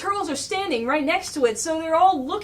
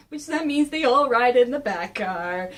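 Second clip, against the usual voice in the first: ordinary talk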